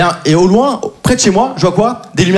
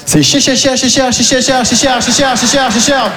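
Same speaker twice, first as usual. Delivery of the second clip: mock stutter; 3 semitones higher, slower than usual. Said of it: "stuttering on "cher".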